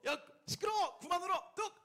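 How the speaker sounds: high, sharp mock scold. Parent pretending to scold a crying baby, mock anger. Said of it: "하면서 혼낼까요".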